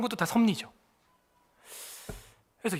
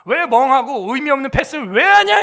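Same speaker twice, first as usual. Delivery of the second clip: louder, stern rebuke; tiger coach scolding; 4 semitones higher, 7 dB louder than usual. Retold or imitated. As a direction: tear into the kid for a lazy pass. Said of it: "호랑이가 되는 거야".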